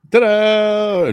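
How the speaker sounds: high, loud showy ta-da; big stage reveal; fanfare ta-da. Pretend. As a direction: unveil the guest with a flourish. Host performs fanfare.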